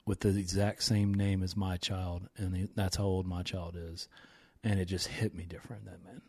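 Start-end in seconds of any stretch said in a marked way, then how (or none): 4.6-6.2 emotional. Real, not acted. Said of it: "I did get emotional".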